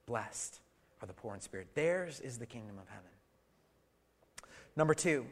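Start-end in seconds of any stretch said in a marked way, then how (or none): 0.1-3.1 soft recitation. Acted. Quiet recitation of the verse.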